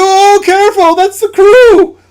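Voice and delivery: urgent shout. Acted. GM warning players loudly.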